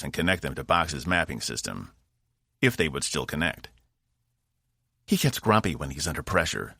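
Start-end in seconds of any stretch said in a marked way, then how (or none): none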